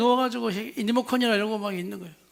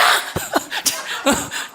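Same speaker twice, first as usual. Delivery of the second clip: high laugh. Real, not acted. Laughs between shouted takes.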